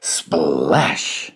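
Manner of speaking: ordinary delivery, nothing marked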